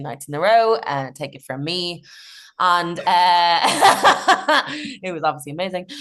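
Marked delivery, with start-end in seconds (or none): 3.6-5.0 laugh. Real, not acted. Laugh at sarcasm.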